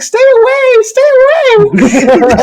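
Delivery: very high, frightened wail, last word drawn out; mock panicked cry. Blind woman fending off clumsy helper, mock panicked cry. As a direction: imitate someone desperately warding off an attacker. Woman fends him off, retold.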